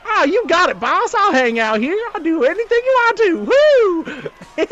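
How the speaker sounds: very high, loud henchman voice; eager henchman. Acted. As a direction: yell it like an overeager sidekick. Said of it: "He goes".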